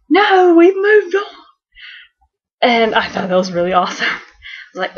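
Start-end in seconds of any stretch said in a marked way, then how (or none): 0.1-1.2 high shouted Tennant impression; mock Tennant shout, much higher; David Tennant voice. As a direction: bark it high and loud like the Tenth Doctor. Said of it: "he has the David Tennant voice".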